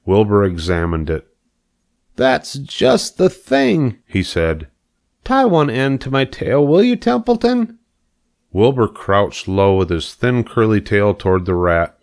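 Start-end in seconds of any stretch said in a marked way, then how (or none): 2.1-4.0 high, drawn-out delight; delighted pig. A narrator's reading. Pig examines the rat's string, delighted. 5.2-7.8 high pig voice climbing to a request; eager pig. A narrator's reading. Pig asks rat to tie string, eager.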